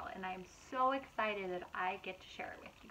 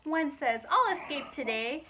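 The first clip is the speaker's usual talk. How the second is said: lively, louder storybook narration; reading to children; 4 semitones higher, 8 dB louder than usual. A narrator's reading. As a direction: read it out brightly to a young audience. Reading picture book aloud.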